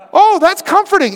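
mock-relieved, loud and high sarcasm; sarcastic relief. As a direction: feign comfort, dripping with sarcasm. Sarcastic reply to brownie joke.

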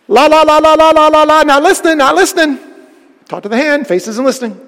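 loud, high sing-song la la la; mock a kid plugging his ears, shouting; hammed-up sing-song refusal; childish not listening. Cain acting out not listening.

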